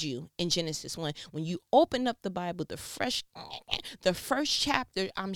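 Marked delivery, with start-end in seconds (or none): none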